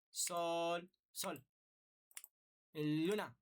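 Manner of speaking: "sol" held long, next "sol" cut short, "luna" quick; badly timed syllables with gaps; uneven rhythm. Shown as a demonstration. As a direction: break the pulse with mismatched lengths. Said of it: "No es correcto porque no estás dividiendo el tiempo".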